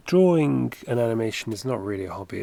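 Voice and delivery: ordinary delivery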